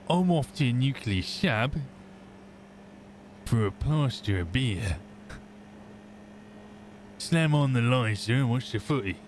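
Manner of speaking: lightly voiced Cockney accent; a hint of a Cockney pub lad; Sean's Cockney voice. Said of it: "So if Sean was to walk to a pub".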